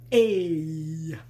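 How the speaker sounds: drawn-out catchphrase impression; Fonzie. Showing off the guinea pig's namesake, drawn-out TV catchphrase impression. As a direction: stretch it out like the Happy Days character. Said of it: "I call him Fonzie after the".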